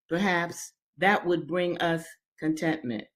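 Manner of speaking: ordinary delivery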